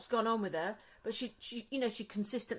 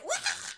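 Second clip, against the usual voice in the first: high squealing scream; imitated scream. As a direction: shriek like noisy kids upstairs. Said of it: "proper screaming".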